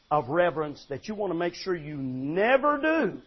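usual speaking voice

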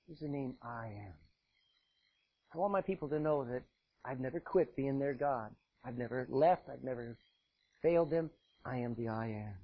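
low, soft reassuring God; gentle reassurance. Acted. God softly reassuring Moses of his name. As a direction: comfort him quietly.

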